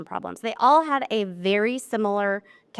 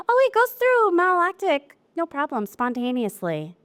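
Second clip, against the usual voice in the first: breezy client; 8 semitones higher than usual. Retold or imitated. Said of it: "I hear again and again and again from my clients".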